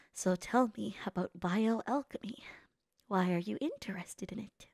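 breathy mock-serious whisper. Streamer mouthing the anime line, soft whisper. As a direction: lean in and whisper the question. Whispering along with character dialogue.

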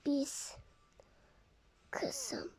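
unvoiced tearful whisper. Parent whispering to daughter before bad news, tearful. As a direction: breathe the words, dreading them.

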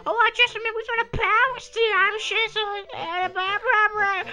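very high, loud squeak; squeaky character voice. Pretend. Gamer voicing a toad talking to Mario, very high squeak. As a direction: squeak it like a tiny cartoon toad. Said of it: "that kinda hurt my side making that voice".

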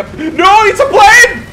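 sudden loud alarmed yell; excited shout. Real, not acted. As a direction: shout it out as you spot it. Spotting a plane mid-game.

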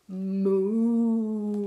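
long loud drawn-out moo; cow moo. Acted. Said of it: "George went".

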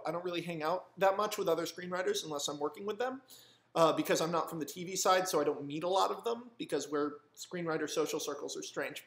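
ordinary talk

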